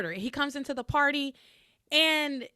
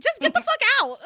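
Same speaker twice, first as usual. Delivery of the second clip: laughing outburst; 12 dB louder than usual. Retold or imitated.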